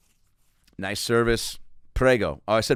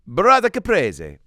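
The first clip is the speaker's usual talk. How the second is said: Italian detective name; 7 semitones higher, 6 dB louder than usual. Acted.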